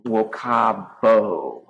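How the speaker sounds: Wokabo slowly, with long, loud A and O; slow, emphatic Wokabo; dominant long vowels. Shown as a demonstration. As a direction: stretch the last two syllables of Wokabo. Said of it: "so I'm gonna go into the B Is".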